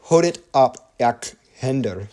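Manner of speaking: careful non-native read of "Hodet opp, ak hender", "Hodet opp" lifted and the words spaced out; Norwegian phrase attempt. Real, not acted. Game theorist reading a Norwegian message to viewers, careful learner pronunciation. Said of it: "I'm gonna mess up some of these Norwegian".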